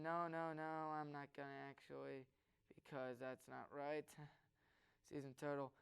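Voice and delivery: half-voiced asides while checking his phone; muttering to himself. Real, not acted. Host distracted by his fantasy baseball app, quietly muttering. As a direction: mumble it under your breath, mostly to yourself.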